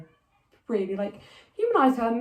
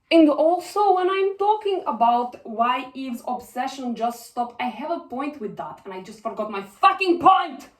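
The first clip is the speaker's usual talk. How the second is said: put-on Russian accent, getting exasperated; Villanelle; 3 semitones higher than usual. Assassin losing her point mid-rant, Russian accent. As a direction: argue in a Russian accent, bursting out at the end. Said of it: "I will turn into Villanelle".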